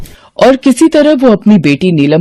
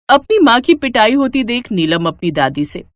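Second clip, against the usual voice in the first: ordinary delivery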